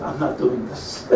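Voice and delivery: quiet, slow line through a laugh; the rabbi refusing, recounted with a soft laugh; laughing quote. Retold or imitated. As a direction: retell his refusal with a chuckle. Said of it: "He said".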